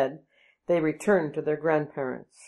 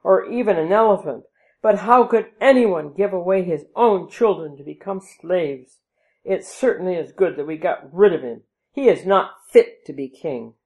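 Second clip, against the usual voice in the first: loud scornful condemnation; indignant; 2 semitones higher, 7 dB louder than usual. A narrator's reading. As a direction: condemn him loudly and fast. Courtiers' indignant tirade.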